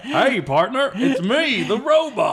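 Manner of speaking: high cowboy drawl, laughing; cowboy robot voice. Retold or imitated. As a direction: play a folksy cowboy robot. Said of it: "he talks like".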